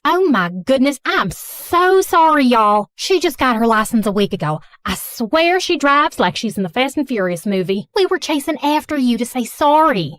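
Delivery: gushing Southern mom apology; thick Southern accent. Acted. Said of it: "speaking in a thick Southern accent".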